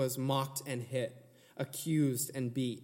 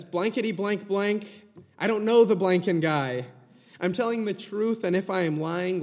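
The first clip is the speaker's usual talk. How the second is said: loud, higher furious cursing, retold from the pulpit; swearing and cursing; 6 semitones higher, 8 dB louder than usual. Peter cursing at the accusers, loud and desperate. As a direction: spit out the oaths like a cornered man. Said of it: "accompanied this third and final denial with swearing".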